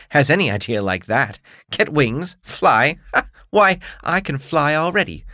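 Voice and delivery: haughty bug demanding his due; beetle voice. Acted. Beetle boasts and scoffs.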